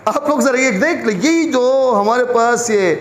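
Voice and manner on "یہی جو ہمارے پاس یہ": ordinary talk, nothing marked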